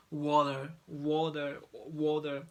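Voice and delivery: repeated flap T drill. Shown as a demonstration. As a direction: tap the T in "Water" like a D. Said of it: "we say it's kind of D".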